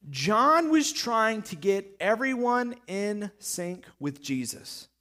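normal talking voice

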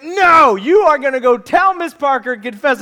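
loud, high, acted parental refusal; forceful mock scolding; stern father. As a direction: lay down the law as an exasperated dad.

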